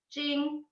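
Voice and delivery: jing held high and level; flat first tone. Shown as a demonstration. Author showing readers the first tone, high level jing. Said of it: "that's the flat first tone".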